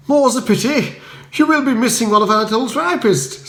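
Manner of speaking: high, bright upper-class chirp; cheery Bertie. Acted. Bertie greeting Spode at the door, high and breezy. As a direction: greet him breezily, unbothered.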